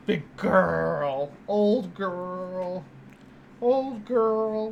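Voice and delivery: drawn-out, crooning doggy voice; slow affectionate croon; doting pet talk. Real, not acted. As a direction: stretch each word fondly for the dog. Baby talk to dog.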